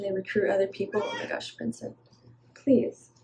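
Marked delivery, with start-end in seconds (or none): 1.0-3.1 unvoiced whisper to someone in the room; whispered aside. Real, not acted. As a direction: drop to a whisper for a quick aside. Aside to Vincent off camera.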